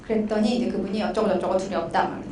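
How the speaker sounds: ordinary talk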